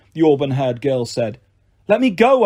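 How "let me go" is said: high, loud demand. A narrator's reading. Percy demanding the hunters let him go. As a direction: insist on being released. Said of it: "I demanded".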